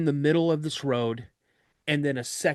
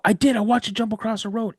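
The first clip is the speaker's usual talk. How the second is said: louder, higher, faster insisting; insistent protest; 8 semitones higher, 4 dB louder, faster than usual. Retold or imitated. Recruit insisting to his instructor, loud and urgent. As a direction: push back hard, almost shouting. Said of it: "I go".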